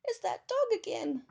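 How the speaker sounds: very high, loud squeal; frightened cry. A narrator's reading. Teddy feeling the dog's hot breath, crying out. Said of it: "he cried".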